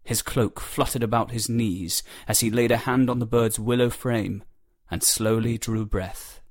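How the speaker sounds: plain reading voice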